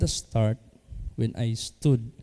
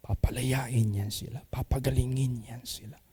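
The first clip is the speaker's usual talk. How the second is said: soft whisper; whispered promise; 7 dB quieter than usual. Real, not acted. Pastor promising the people will be freed, whispered. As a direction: breathe the promise out softly. Hushed declaration in sermon.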